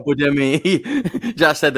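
slow, laughing question. Real, not acted. To his cohost, laughing in disbelief. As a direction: laugh through the words.

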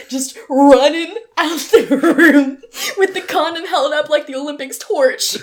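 high, loud, laughing exclamation; loud laughing climax. Real, not acted. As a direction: shout the punchline, cracking up. Climax of the comic story.

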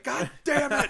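high, loud exasperated curse; frustrated outburst. Retold or imitated. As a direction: blow up at the useless phone. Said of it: "it's like".

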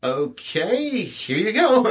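high, fake-sweet handover; high sugary customer-service voice; mock polite. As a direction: hand it over with forced politeness.

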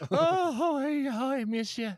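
high, loud mock Hawaiian accent. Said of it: "I thought we were doing our Hawaiian accent".

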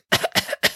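short, dry-acted coughing fit. A narrator's reading. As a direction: cough as if bothered by smoke. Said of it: "coughed Madame Zandra".

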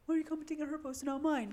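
fast, petulant jealous question; jealous whine. Retold or imitated. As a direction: play the jealous friend, quick. Imitating jealous friend.

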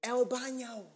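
put-on high-pitched Spanish in an American accent. Customer asking a waiter for the bathroom, American-accented Spanish. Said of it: "said it in, in an American accent".

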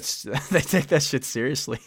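amused, laughing as it comes out. Real, not acted. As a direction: let a chuckle lift the words. Laughs while restarting the phrase.

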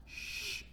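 soft "shh"; hushing shush. Acted. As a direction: hush someone down. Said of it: "didn't want him to say nothing".

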